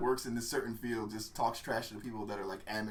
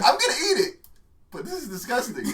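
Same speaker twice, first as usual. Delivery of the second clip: mock-indignant British chef, pitched up; loud, high start, easing into disgust; Gordon Ramsay; 7 semitones higher, 4 dB louder than usual. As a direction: imitate a fuming British chef. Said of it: "he has a British accent and he's, like, so angry".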